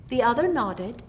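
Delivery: usual speaking voice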